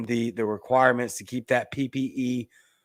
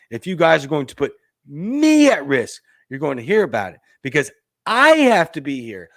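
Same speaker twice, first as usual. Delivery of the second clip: scornful emphasis; 8 dB louder than usual. Shown as a demonstration. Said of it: "but listen to the phrase".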